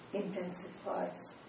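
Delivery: usual speaking voice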